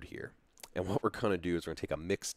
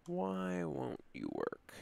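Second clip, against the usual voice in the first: softer, slower weary plea; pleading with a machine; 11 semitones higher, 6 dB quieter, much slower than usual. Real, not acted. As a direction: sigh the question at it. Begging again, lower.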